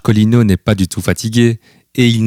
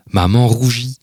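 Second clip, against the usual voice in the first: plain reading voice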